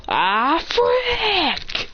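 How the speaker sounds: rising into a high, drawn-out cry; mock yell of a falling character; acted falling cry. Character falls off the train.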